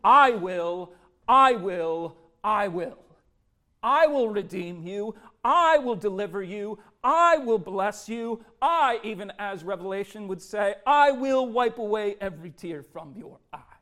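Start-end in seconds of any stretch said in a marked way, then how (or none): 0.0-9.0 louder, solemn promises of God, each I will weighed; voice of God promising. Acted. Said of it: "you can hear God's voice saying". 10.9-13.8 louder, solemn promises of God, each I will weighed; voice of God promising. Acted. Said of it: "you can hear God's voice saying".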